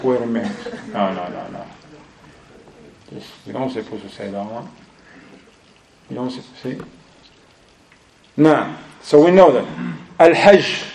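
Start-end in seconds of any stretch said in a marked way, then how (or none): 0.0-6.8 low, hushed self-correction; attentive pupil's second thoughts. Acted. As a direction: drop to a soft mock inner voice. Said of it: "those who pay attention, he's like".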